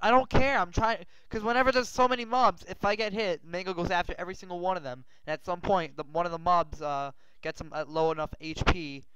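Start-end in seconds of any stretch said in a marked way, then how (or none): 0.0-4.8 heated reply. Real, not acted. Arguing back while fighting.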